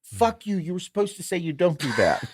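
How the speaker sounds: high-pitched mock protest; playfully betrayed by a buddy's answer; mock outrage.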